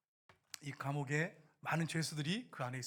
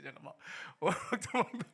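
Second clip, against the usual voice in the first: ordinary talk, nothing marked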